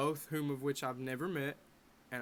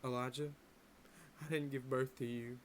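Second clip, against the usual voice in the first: softer grave take; 6 dB quieter than usual. Retold or imitated. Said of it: "But it's more like".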